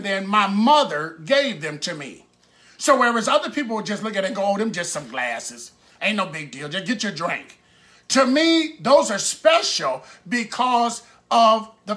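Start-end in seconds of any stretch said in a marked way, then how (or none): 4.4-7.6 low, offhand shrug of a voice; mock an unimpressed onlooker, lower in pitch; dismissive. Most people looking at his treasured glasses, dismissive.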